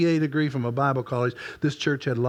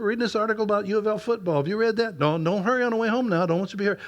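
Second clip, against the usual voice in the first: higher, eager and friendly; greeting a churchgoer, bright and eager; cheerful church voice; 8 semitones higher, faster than usual. Retold or imitated. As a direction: perk up for a church member. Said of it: "if that had been an average couple person from church".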